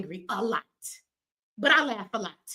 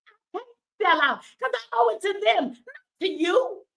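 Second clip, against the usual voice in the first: voice breaking; 8 semitones higher than usual. Real, not acted. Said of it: "my crying, angry ass".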